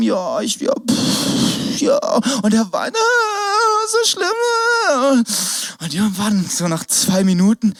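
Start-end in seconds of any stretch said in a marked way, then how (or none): 3.0-5.2 high whiny crying voice; mock toddler wail. Toddler crying after falling off his scooter, mock wail. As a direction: wail like a toddler who fell.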